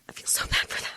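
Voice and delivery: breathy whisper of pity; soft whispered aside; whispered sympathy. Real, not acted. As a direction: drop to a whisper, sorry for him.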